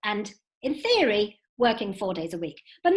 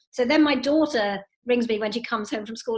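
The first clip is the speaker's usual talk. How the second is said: ordinary talk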